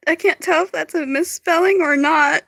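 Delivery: talking through laughter; giggling, barely holding it together; laughing. Real, not acted. Laughing at a typo.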